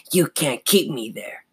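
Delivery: snarling puppet taunt; menacing, defiant character voice; evil dummy voice. A narrator's reading. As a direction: taunt her like a living dummy. Evil dummy defies the girl.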